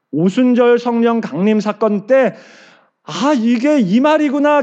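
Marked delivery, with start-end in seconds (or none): 3.0-4.6 dawning realisation. Retold or imitated. Disciples realise at Pentecost.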